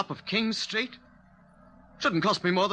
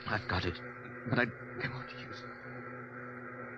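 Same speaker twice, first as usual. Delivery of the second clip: soft, low murmur; secretive, reluctant undertone; hushed reply; 7 semitones lower, 12 dB quieter, slower than usual. Acted. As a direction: whisper back to an accomplice, trailing slower. Secret reply behind the driver's back.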